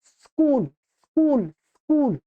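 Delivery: स्कूल said slowly and clearly, s+k cluster; correct pronunciation. Shown as a demonstration. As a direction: model स्कूल for students, no vowel before स. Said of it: "मैं आराम से उच्चारण कर रहा हूं". ("स्कूल" धीरे और साफ़, बिना "इ" या "अ" के)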